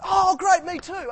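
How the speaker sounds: high, loud mock delight; hammed-up joyful exclamation; overjoyed agreement. Acting overjoyed agreement in a joke.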